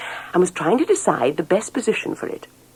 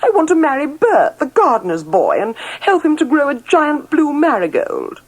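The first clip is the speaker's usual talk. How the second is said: higher, louder insistent demand; 11 semitones higher, 4 dB louder than usual. A narrator's reading. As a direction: plead stubbornly for Bert. Princess protesting to parents.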